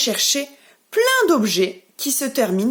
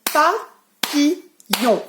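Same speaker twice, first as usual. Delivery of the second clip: papillon drawn out very slowly, pa-pi-llon, higher; clapped syllables; 4 semitones higher, much slower than usual. Shown as a demonstration. Teacher claps syllables with the children, very slow beats. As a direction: clap out papillon one beat at a time. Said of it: "On y va ensemble".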